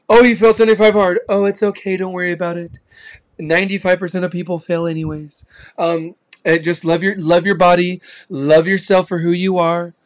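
a hint of a syrupy comforter excusing failure; coddling fake cheerleader. Fake friend coddling someone who quit, mild sugary comfort. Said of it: "a fake cheerleader that's like".

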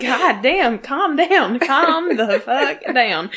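scolding an overexcited character; mock exasperated yell. Fan yelling at Sam on screen, loud and exasperated.

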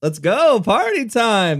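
high hype shout; party time, hyped and high; excited. Real, not acted. Hyping up the anniversary.

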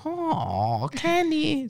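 high drawn-out call of Kenny in a mock Chinese accent; a teasing, sing-song Chinese-accent call of Kenny. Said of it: "did they do that in, like, a Chinese accent?".

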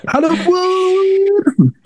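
long, high, drawn-out cry of the name; drawn-out shout. Real, not acted. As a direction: stretch the name into a wail.

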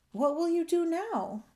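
high, wondering question; exclaiming. A narrator's reading. Bullfrog asking his homeless friends, exclaiming. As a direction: ask it amazed, pitched well up. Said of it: "Jubal exclaimed".

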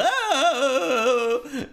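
sudden high yelp; high sudden silly Oh; stupid yelp. Retold or imitated. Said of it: "sometimes he just goes".

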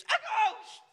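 high-pitched squealed scream; mock terrified scream. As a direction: shriek it like a panicked disciple. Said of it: "one of them screams".

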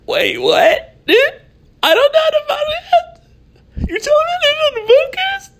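high squeaky shocked voice with a half laugh; mock disbelief. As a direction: act stunned in a high, cracking squeal. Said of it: "stop me in my tracks to be like".

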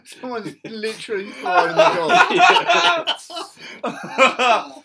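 slow, high and loud laughing; cracking up. Real, not acted. Laughing at the thrown dog.

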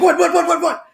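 high, rapid-fire repetition; alarmed mock babble; startled panic. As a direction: imitate someone jolted awake. Startled woken dad acted out.

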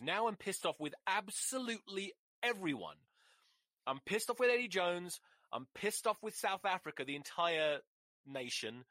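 high-pitched, exasperated tirade; really angry, pitched well up; pissed-off rant. Real, not acted. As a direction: rant down a list of grievances. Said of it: "This is the most angry I've been".

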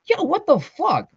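high, drawn-out outburst of disbelief; stretched-out, high-pitched challenge; exclaiming. A narrator's reading.

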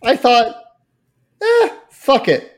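put-on high, slow, shrugging little girl voice; imitating daughter. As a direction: toss it off like a careless kid. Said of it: "she goes".

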